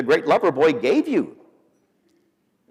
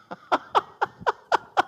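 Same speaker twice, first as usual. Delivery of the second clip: high, staccato mock laugh; put-on string of high ha-ha laughs; imitated laughing. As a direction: act out a helpless high-pitched giggle. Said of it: "just laughing like that".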